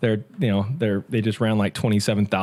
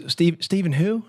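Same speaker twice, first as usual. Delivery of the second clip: higher, mock-puzzled Cajun voice; a light Cajun, playing dumb; Cajun accent; 6 semitones higher than usual. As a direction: Cajun teasing, pitched higher. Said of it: "you had to do the accent".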